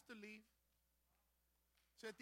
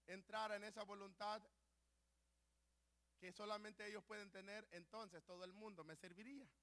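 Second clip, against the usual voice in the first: much softer, lower, hushed; God's soft voice; 4 semitones lower, 6 dB quieter than usual. Retold or imitated. God gently explaining to the preacher, hushed. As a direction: speak as God, gently and quietly. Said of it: "sentí que Él me dijo".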